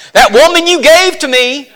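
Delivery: high, loud whining blame; mock accusing Adam; Adam's excuse. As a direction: point the finger like Adam. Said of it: "Sounds a lot like Adam".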